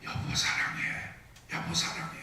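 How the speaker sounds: soft, voiceless whisper; hushed intimate whisper; whisper in the ear. Acted. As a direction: whisper it close, like a secret for a spouse. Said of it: "귀에다 대고 말합니다".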